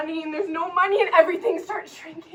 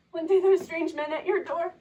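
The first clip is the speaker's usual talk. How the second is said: normal talking voice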